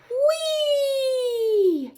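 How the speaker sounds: high, loud sliding squeal; gleeful whee. Pretend. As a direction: shriek with joy as you slide down. Penguin sliding into sea.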